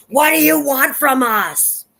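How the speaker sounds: high, loud desperate scream; screaming at the house. Retold or imitated. George screams at the house, desperate loud scream. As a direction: hurl it at the unseen force, pleading. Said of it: "George screams at Wrath".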